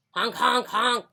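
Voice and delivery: playful traffic honks; car horn honks. Acted. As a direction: imitate passing car horns. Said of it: "kept speeding by and honking".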